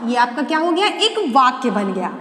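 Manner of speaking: ordinary delivery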